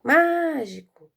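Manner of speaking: high, loud call with a long MA in mágico; slow, drawn-out loud call. Shown as a demonstration. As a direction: call mágico as if outside, holding the first syllable. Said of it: "nós vamos chamar".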